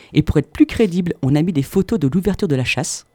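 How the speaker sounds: ordinary talk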